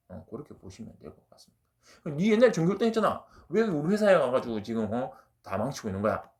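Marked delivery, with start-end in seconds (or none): 2.2-6.3 loud, high mock scolding that eases off; heated imitation of an accusation; confrontational blame. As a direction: act out someone chewing out a failed employee. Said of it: "그냥 따지려고 보는 겁니다".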